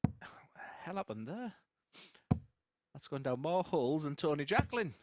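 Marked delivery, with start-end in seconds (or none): 0.0-1.5 spluttering, Coke up the nose; choking on Coke. Real, not acted. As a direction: talk through a coughing splutter. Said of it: "It's coming out my nose".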